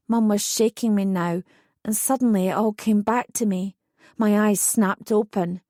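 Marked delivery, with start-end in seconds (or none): none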